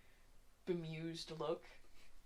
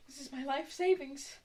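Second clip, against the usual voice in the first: put-on high, upset old lady voice; distraught old woman; 10 semitones higher than usual. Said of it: "She starts wailing again".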